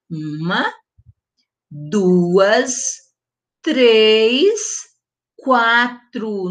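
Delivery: counting with pauses between numbers; counting aloud. Shown as a demonstration. Teacher counting vowels with children, spaced out. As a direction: count slowly with the class. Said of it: "Vamos contar".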